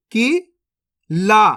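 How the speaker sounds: Qila stretched very slowly, sounds held; slow teaching demo; drawn out slowly. Shown as a demonstration. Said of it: "अब चलिए आगे देखिए".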